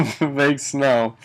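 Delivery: normal talking voice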